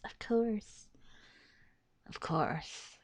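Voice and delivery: nearly whispered, drained resignation; resigned muttering. Real, not acted. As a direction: let it sink to a bitter murmur. Resigned after character ruins things.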